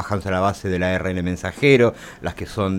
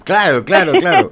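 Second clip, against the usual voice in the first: higher and louder, laughing; laughing agreement; 8 semitones higher, 7 dB louder than usual. Real, not acted. Host agrees about the province, laughing loudly.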